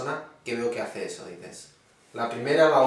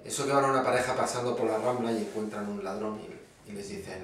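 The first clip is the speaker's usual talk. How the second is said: usual speaking voice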